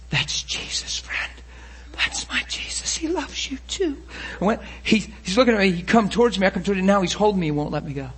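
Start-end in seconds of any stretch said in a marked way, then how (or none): 0.1-4.0 whispered, tender assurance; hushed whisper. Retold or imitated. Preacher telling the healed man about Jesus, hushed whisper. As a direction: whisper it as a precious secret.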